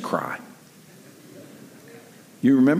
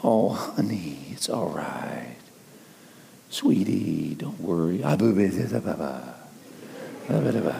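put-on soothing a baby; 6 semitones lower, 6 dB quieter than usual. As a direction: hush her gently, low and tender. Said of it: "What do we do?".

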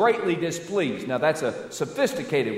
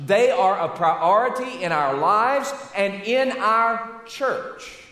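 preaching at full force; 4 semitones higher than usual. Acted. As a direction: drive the point home to the congregation.

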